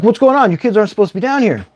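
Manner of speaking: a hint of a scolding cop; imitating the cop. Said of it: "he's like".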